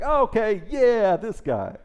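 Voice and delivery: high, loud, drawn-out cheer; mock crowd approval. Fickle crowd backing the new guy, mock excited cheer.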